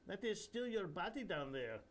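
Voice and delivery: a playful Indian holy man teasing; Indian accent. Acted. Indian guru chiding a floating man, Indian accent. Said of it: "in a highly nasal Indian accent".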